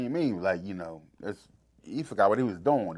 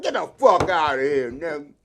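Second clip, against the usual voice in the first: mock disbelief; 5 semitones higher, 11 dB louder than usual. Boogie's stunned disbelief voiced.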